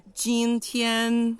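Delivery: "今天" with both syllables high and level; first plus first tone. Shown as a demonstration. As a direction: say "今天" slowly, each syllable held high and flat. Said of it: "primeira e a primeira entonação". ("今天" com dois tons altos e planos, devagar)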